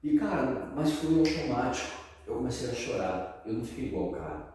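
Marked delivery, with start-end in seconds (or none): none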